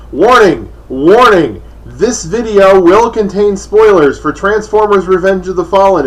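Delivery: loud, emphatic warning announcement; spoiler warning. Shown as a demonstration. Reviewer warns viewers of movie spoilers, loud warning. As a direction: announce it loudly, drawing the words out.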